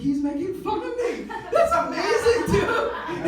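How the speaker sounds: high, loud fanboy thrill; giddy excitement. Retold or imitated. Comic telling crowd how thrilled he was when teased, giddy excitement.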